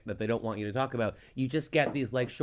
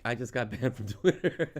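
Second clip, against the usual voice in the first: laughing. Retold or imitated. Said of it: "where you were like".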